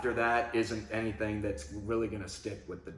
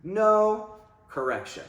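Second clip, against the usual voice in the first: louder, higher firm marker; firm, no scolding; 11 semitones higher, 8 dB louder, slower than usual. Shown as a demonstration. Trainer marking a mistake, firm not angry. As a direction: stop it without anger. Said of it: "I don't scold in my tone".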